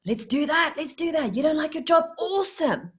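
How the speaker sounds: high-pitched gleeful agreement; eager puppy. Acted. Puppy happily agreeing to cause stress, eager. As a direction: jump on the idea with mock delight.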